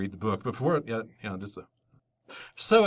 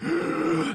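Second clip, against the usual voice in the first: excited gasp; 3 dB louder than usual. Retold or imitated. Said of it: "I was like".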